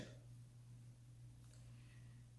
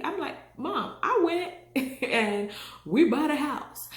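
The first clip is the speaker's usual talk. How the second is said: much higher, louder indignant protest; 7 semitones higher, 6 dB louder than usual. Retold or imitated. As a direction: defend yourself to mom, offended. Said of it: "I said".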